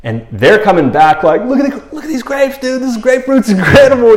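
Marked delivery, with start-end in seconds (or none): 1.5-4.2 high, excited, laughing spy report; fired up. Retold or imitated. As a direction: gush over the huge fruit with a laugh. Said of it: "they're fired up".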